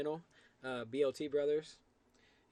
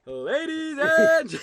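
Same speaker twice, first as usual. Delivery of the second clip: booming, drawn-out announcer call; fighting game announcer; 14 semitones higher, 15 dB louder, much slower than usual. Pretend. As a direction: draw it out like a ring announcer. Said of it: "get my announcer voice on".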